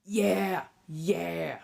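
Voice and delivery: normal talking voice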